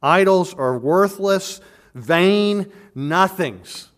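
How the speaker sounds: preacher thundering the warning out word by word; emphatic proclamation. Acted. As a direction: drive it home with full force. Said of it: "as emphatically as he can possibly write it".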